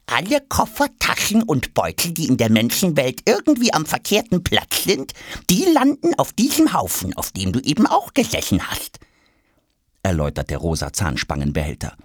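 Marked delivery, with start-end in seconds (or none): 0.1-9.0 high-pitched small creature's voice; explaining little figure. A narrator's reading. Pink brace box explains. (hohe Figurenstimme, erklärend)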